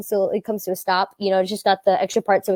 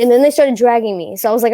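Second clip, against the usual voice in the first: usual speaking voice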